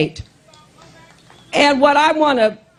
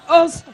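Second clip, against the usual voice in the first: word broken by a cough; coughing fit; 6 semitones higher than usual. Real, not acted. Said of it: "excuse me".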